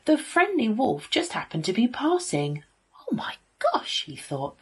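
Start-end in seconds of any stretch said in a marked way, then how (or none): 3.0-4.0 very high gasp of horror; shocked. A narrator's reading. Friendly wolf seeing the poor chickens, shocked. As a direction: exclaim aghast in a high voice.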